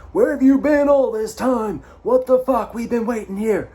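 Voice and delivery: high, loud indignant shout; officer berating them for the long wait, angry shout. Retold or imitated. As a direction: blast them for being late. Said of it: "And he said".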